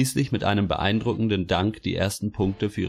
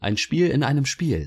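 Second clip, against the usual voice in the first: official; 7 semitones higher, 3 dB louder than usual. A narrator's reading. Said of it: "sagt der Beamte".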